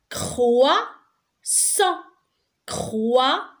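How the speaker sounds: croissant split into croi... ssant; syllable by syllable. Shown as a demonstration. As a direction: sound out croissant in two separate beats. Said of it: "Deux syllabes".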